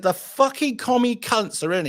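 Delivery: telling the barman the news with exaggerated contempt; retold angry outburst. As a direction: spit it out with mock disgust.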